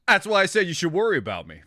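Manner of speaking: laughing through the words; giggling as you speak; amused, laughing it out. Real, not acted. Laughing while talking.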